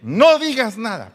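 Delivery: loud, high, forceful warning, NO hit hard. Acted. Preacher warning husbands, forceful loud order. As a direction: shout "No digas nada" like a stern order.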